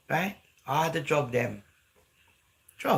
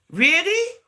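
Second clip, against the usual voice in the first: mock disbelief; 13 semitones higher, 7 dB louder than usual. Real, not acted. Scoffing at fake speech.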